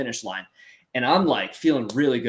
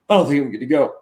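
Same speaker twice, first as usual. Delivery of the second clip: nervous reluctance; 3 semitones higher, 6 dB louder, faster than usual. Retold or imitated. Nervous quote at the start gate.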